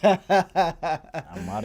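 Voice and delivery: slow, drawn-out laughing. Real, not acted. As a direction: stretch the name out, laughing. Own name, laughing.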